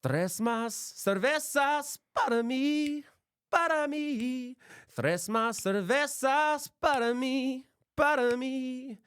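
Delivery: high, slow, exaggerated Spanish accent; sing-song the order way up high and slowly in a fake Spanish accent; hammed-up Spanish. Ordering beers for himself, hamming up a mock Spanish accent.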